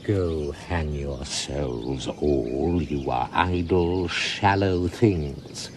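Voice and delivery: low, slow haughty disdain; low slow disdainful dismissal; contemptuous scorn. Acted. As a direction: look down your nose. Malvolio scorning them.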